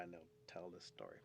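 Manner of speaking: plain reading voice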